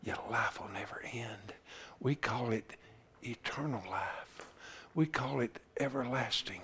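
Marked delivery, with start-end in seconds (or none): 0.0-1.5 unvoiced, soft whisper, lower. Preacher promising the congregation eternal life, whispering. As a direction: breathe it like a secret.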